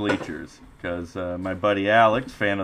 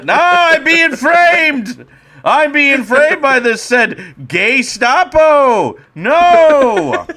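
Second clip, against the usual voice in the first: high, loud, slow shrieking protest; 9 dB louder, much slower than usual. Acted. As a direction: shriek as you're dragged off. Dragged away, shrieking.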